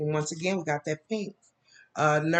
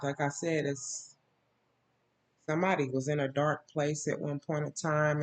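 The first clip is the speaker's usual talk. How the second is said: normal talking voice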